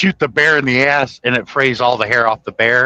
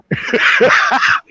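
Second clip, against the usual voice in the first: laughing; 12 semitones higher than usual. Real, not acted. Laughing at own anecdote.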